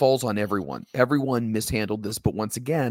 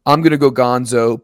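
loud declared threat; 9 dB louder than usual. Retold or imitated. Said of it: "or do you say".

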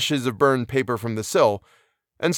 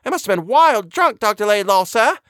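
very high, louder flustered housekeeper; breathless exclamation; 5 dB louder than usual. A narrator's reading. Housekeeper panting upstairs behind the doctor, breathless exclamation. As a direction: exclaim it in a high, agitated put-on voice. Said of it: "she exclaimed breathlessly".

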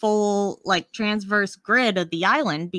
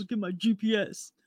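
softly put-on impression; Steve Gupta; 6 dB quieter than usual. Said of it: "That's my best Steve Gupta impression".

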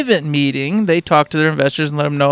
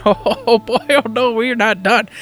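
higher-pitched swaggering tease; hyped presenter; 5 semitones higher, slower than usual. Pretend. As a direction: tease the crowd like a pumped-up showman. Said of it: "and he's like".

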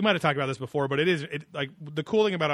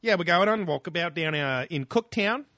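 mock Australian accent; 4 semitones higher, 4 dB louder than usual. Slips into Australian talk after accent joke.

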